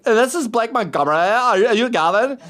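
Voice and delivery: high, mock-camp caller; Blake Montgomery. BuzzFeed writer introducing himself to the host, mock camp voice.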